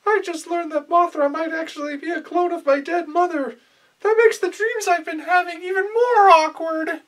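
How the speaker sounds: put-on high, whiny young boy voice. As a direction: play a timid little anime boy. Boy confiding to father figure.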